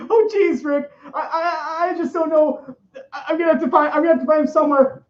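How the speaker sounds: high, whiny, stammering Morty with a nervous laugh; Morty impression. Morty answers Rick, a nervous, giggly stammer. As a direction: fret and stumble like a flustered teen. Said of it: "an okay impression of Morty".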